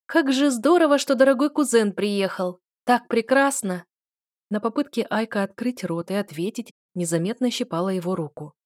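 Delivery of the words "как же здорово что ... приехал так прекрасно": bright, loud and gushing. A narrator's reading. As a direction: show off loudly for the crowd. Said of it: "нарочито громко вещала". (нарочито громко)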